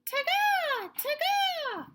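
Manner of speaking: very high, loud ta-ga call; lizard call. Acted. Reading to children, high lizard chirp.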